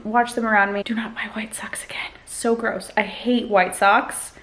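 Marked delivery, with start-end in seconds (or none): 0.8-2.9 hushed whisper to the husband. Real, not acted. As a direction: whisper it like a secret aside. Whispered aside to husband Josh.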